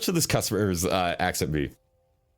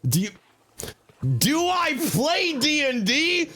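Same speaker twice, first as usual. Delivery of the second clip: slow, much higher, drawn-out boast; mock grandiose; 10 semitones higher, slower than usual. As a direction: puff it up like a proud legend. Mock boastful answer to chat.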